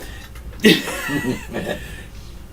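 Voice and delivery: breathy high-pitched giggle; high unvoiced cackle. Real, not acted. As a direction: burst into a wheezing laugh. Laughs at own cheap shot.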